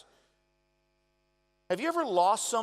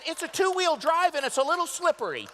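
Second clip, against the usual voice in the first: mock mom complaining; 13 semitones higher, 8 dB louder than usual. Mother voiced, shouting complaint.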